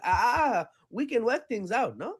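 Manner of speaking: put-on high, loud Nigerian accent pleading; a playful Nigerian accent, placating. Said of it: "I don't know if that's a Nigerian accent".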